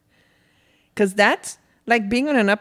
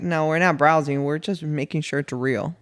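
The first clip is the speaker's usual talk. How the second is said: inner thought; 5 semitones lower than usual. Acted. Shopper admits the truth in their own mind, low aside. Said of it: "in my mind".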